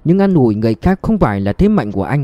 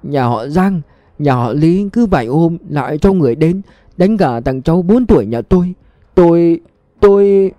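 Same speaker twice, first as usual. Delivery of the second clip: weeping account; 5 semitones higher than usual. A narrator's reading. Grandmother recounts attacks on her grandson, weeping. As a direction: tell it through tears until the voice breaks. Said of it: "vừa khóc vừa kể".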